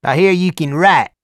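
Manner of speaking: big Southern biker voice; Deep South accent. A narrator's reading. Hell's Angel asking Morris, loud Deep South accent. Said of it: "with an unmistakable Deep South accent".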